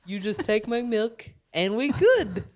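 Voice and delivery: Russian-sounding goat voice; a goat pleading in a thick accent; goat impression. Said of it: "that is my goat impression".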